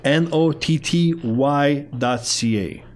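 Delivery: drawn-out letters for someone asking the spelling. Real, not acted. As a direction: spell out each letter slowly and distinctly. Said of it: "How do you spell it".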